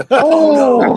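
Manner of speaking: loud, high laughing oh no; shocked. Real, not acted. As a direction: react in loud disbelief. Reacting to the mishap.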